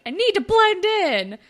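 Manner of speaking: high and loud insistence; mock earnest. [name] defending her outfit to Claire, high mock earnest. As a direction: squeak it out, very earnest.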